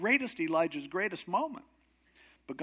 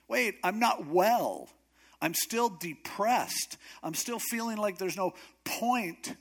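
high, slow, emphatic whine; exasperated protest; 6 semitones higher than usual. Acted. Elijah objects when God says get moving, exasperated. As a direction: drag out each complaint.

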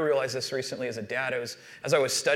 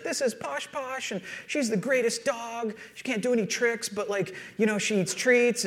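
high-pitched imitation of a gushing little girl; bragging young daughter; 8 semitones higher than usual. Daughter showing off the dog to her friend, bragging imitation. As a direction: gush proudly in a lifted kid voice. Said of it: "she's just bragging about our dog".

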